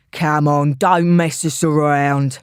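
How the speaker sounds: a rough London heavy growling it; old London accent. A narrator's reading. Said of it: "with an old-style London accent".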